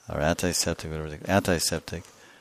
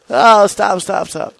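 very high cry for mercy, fading after the first burst; high-pitched play-acted panic; mock pleading; 13 semitones higher, 10 dB louder, slower than usual. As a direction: beg like a beaten opponent, hammed up. Acted victim begging the attacker.

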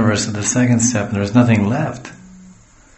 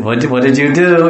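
raised voice, telling off a dog; higher, louder mock reprimand; mock scolding; 4 semitones higher, 6 dB louder than usual.

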